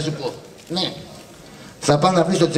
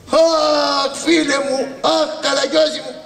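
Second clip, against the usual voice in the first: hammed-up mourning wail; 7 semitones higher, slower than usual. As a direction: wail high like a mourner at a wake. Mock lament over Karagiozis. (θεατρικός θρήνος για τον Καραγκιόζη)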